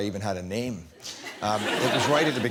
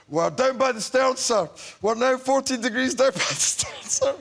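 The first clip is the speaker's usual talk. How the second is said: higher, singsong mock delivery; nervous naval report; 11 semitones higher, 3 dB louder than usual. Her one line at last, singsong naval report. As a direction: deliver the line as a keyed-up junior officer. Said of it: "when they come round to me and I say".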